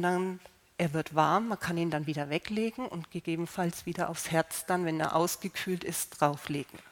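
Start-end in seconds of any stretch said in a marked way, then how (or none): none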